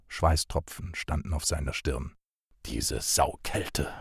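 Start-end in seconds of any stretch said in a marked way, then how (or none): 2.6-3.9 low, slow, soft groan against the cold; a slow, low groan of cold misery. Acted. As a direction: moan it out, drawn long and low. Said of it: "stöhnte er".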